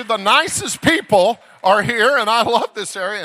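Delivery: loud, high revival preaching; full-force preaching. Acted. Preacher praising Wisconsin kindness to the church, loud and high.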